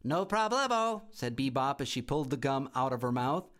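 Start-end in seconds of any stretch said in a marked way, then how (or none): 0.0-1.0 put-on a cheeky little girl laughing at the house; giggly bratty girl. A narrator's reading. Girl giggling at her new home, high and cheeky.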